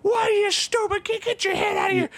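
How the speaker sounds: very high, loud screaming-coach parody; mock yelling. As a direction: scream like an unhinged coach berating a kid. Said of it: "I'm not yelling".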